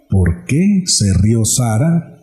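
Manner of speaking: God asking Abraham why Sara laughed, low and slow. Acted. God rebukes Sara through Abraham.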